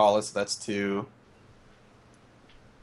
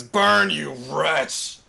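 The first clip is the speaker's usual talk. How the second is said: drawn-out, raised spellcasting cry; exclaimed battle cry; 5 semitones higher, 4 dB louder, much slower than usual. Acted. Player's character readies a spell, exclaimed battle cry. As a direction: hurl it out slowly, higher in pitch. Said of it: "he exclaims".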